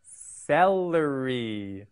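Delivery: very slow, stretched-out celery; drawn-out model word. Shown as a demonstration. Teacher models celery for learners to repeat, drawn out slowly. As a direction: stretch each syllable for learners to copy. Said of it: "You say it".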